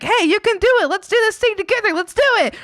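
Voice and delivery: high, loud pep-talk cheering; mock cheerleader pep. Cheering friends on to motivate.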